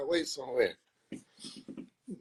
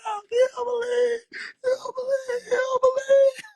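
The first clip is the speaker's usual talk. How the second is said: mock wail. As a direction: wail it over and over, hammed-up. Acted wailing continues after aside.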